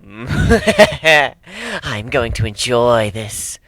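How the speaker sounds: very slow words through a witch's cackle; witchy cackle. Acted. Trixie relishing the coming fight, cackling like a witch. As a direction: cackle with wicked glee. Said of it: "Trixie cackled like a witch".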